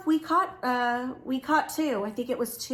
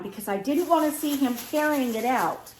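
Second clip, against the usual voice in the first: ordinary talk, nothing marked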